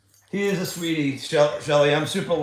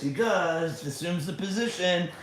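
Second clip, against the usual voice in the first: ordinary delivery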